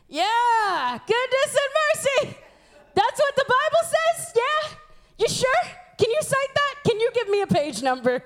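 high-pitched, doubting questions; mock scepticism. Doubter asking for proof of goodness and mercy, sceptical. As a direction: question it like you don't buy it.

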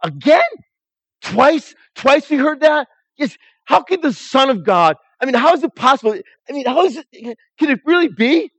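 high, loud, incredulous questions; can't believe what he's hearing; a disciple amazed and pressing his friend with questions; astonished disbelief. Acted. Disciple stunned by retold miracle.

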